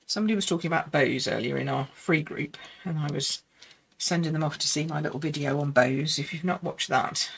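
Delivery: ordinary delivery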